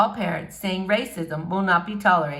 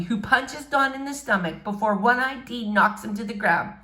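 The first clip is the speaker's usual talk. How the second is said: ordinary delivery, nothing marked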